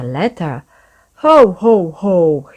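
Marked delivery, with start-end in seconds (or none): 1.2-2.5 loud, round ho ho ho; Santa's jolly laugh. A narrator's reading. Santa laughing over the children's letter, jolly and loud. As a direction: belly laugh like Father Christmas. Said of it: "he laughed".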